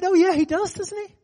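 high-pitched dawning realization; disarmed agreement. Retold or imitated. Challenger disarmed by the pastor's answer, surprised agreement. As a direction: concede it as if suddenly won over. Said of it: "it's like it just disarmed him".

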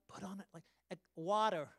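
"water" as American "wadder", flapped d and strong r; husband orders the American way so the speaker understands; American accent. Retold or imitated. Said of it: "I'm usually like".

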